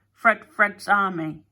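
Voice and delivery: loud, slow proud announcement. A narrator's reading. Fred presenting his army, loud proud announcement. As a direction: declare it like a proud little general. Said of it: "he announced".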